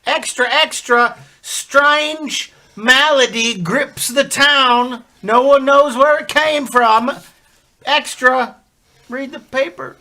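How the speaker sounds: loud, high street call; newsboy calling out. Acted. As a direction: hawk papers like a newsboy. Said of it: "we hear a newsboy call out".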